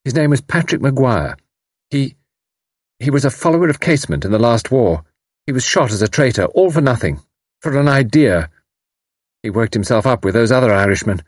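high, bitter, rebellious telling; pent-up rebellion. Acted. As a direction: let long-held resentment spill out. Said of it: "the note of pent-up rebellion".

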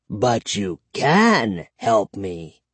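slow, low vampire declaration; declaring vampire. A narrator's reading. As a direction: press the point slowly and ominously. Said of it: "he declared".

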